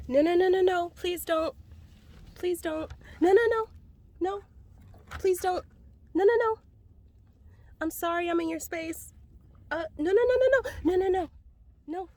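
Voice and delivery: high, loud, frantic no's; begging the horse to stop, scared; panicked pleading. Real, not acted. Horse coming at her, pleading.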